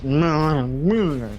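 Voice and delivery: hammed-up incoherent drunk; wordless drunk mumbling. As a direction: smear the noes into a drunk groan. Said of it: "so drunk they can't even talk anymore".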